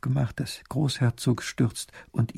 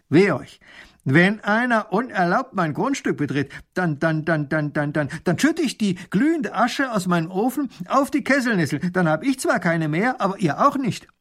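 angry; 7 semitones higher, 4 dB louder than usual. Acted. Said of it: "Da wird der Alte richtig böse".

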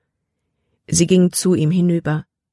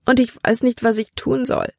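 hohe, verzweifelte Klage; verzweifelt; 7 semitones higher than usual. Acted. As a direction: lass die Stimme hilflos hochsteigen. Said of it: "schluchzte sie". (hoch und verzweifelt weinend)

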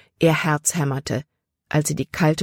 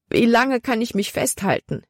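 desperate; 7 semitones higher than usual. A narrator's reading. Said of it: "fragte sich Libby verzweifelt".

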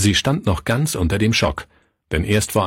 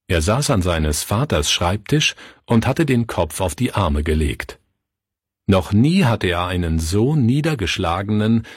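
ordinary delivery

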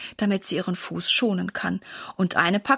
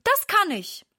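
put-on higher, bright-eyed little girl reassuring her mum; eager young girl; 10 semitones higher than usual. A narrator's reading. Daughter promises her hurt mother to fetch Papa, eager girl voice. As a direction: play the keen daughter rushing off to help.

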